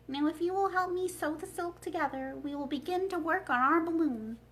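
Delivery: a hint of a thoughtful old wizard; Oz's voice. The wizard shares his balloon plan with Dorothy, lightly voiced. Oz asks for help.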